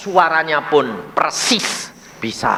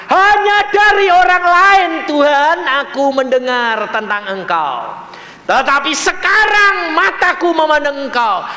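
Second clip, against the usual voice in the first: loud, high-pitched scripture proclamation; preacher's ringing high cry; impassioned declaiming; 13 semitones higher, 5 dB louder than usual. Acted. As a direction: proclaim Job's words at full force. Preacher declaims Job's confession.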